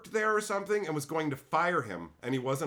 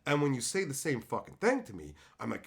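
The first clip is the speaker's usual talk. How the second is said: ordinary delivery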